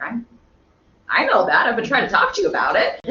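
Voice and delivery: exasperated retort. Retold or imitated. Teacher retelling reply to failing student, exasperated. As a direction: push back with mock exasperation, up and loud.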